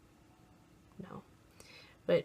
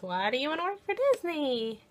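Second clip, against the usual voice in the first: higher, bright-eyed recruiter singsong; mock interviewer question; 8 semitones higher, 4 dB louder than usual. Retelling the Disney interviewer's question, in a mock higher voice. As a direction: play the cheery interviewer asking it. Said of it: "she didn't really ask it like that".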